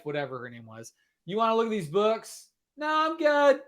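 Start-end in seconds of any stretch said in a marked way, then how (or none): 1.3-2.5 mock salesman voice. Retold door-to-door pitch. 2.8-3.6 high, sneering mock of the guy; high-pitched mockery of the other guy. Mimicking a dismissive man.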